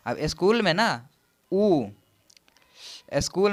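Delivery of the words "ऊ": "ऊ" alone as a held vowel; teacher sounding out "ऊ"; vowel demonstration. Shown as a demonstration. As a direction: isolate the long "ऊ" sound. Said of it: "ऊ का sound produce हो रहा है".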